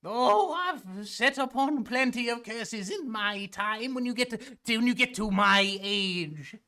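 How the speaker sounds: high, sweet shopkeeper voice; mud lady voice. Acted. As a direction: play a sugary old merchant.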